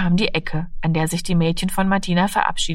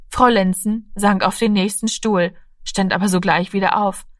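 ordinary delivery

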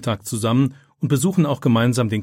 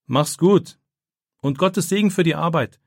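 higher-pitched friendly call after someone; 6 semitones higher, 3 dB louder than usual. A narrator's reading. Friend calls good wishes after Philipp leaving for the test, calling out. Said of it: "ruft Torben ihm nach".

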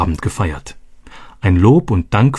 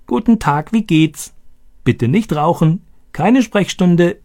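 high, croaking parrot voice; Boga; 9 semitones higher than usual. Acted. Owner mimics his parrot for the planners, high croaky squawk. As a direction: squawk stock phrases like a talking parrot. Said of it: "Stani krächzte diese Sätze wie Boga".

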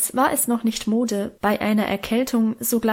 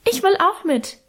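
put-on much higher child's piping voice; 9 semitones higher than usual. A narrator's reading. Little Hermann begging his mother to come, high piping. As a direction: squeak it like a tiny boy. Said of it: "piepste unser kleiner Hermann".

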